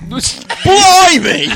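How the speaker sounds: high, loud Cockney-style Blimey; hammed-up British accent; mock British exclamation. Mocking foreign accents.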